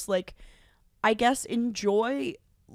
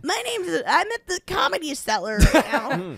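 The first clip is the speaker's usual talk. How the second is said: high, cartoonish Aziz; South Park-style Aziz; 7 semitones higher, 4 dB louder than usual. Aziz as a South Park character, high. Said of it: "like he's a South Park character".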